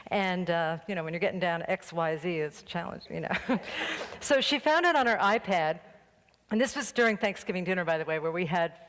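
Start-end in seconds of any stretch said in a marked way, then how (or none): none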